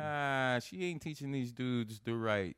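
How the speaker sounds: scoffing. Retold or imitated. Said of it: "I'm like".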